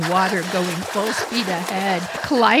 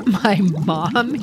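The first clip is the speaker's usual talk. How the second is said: normal talking voice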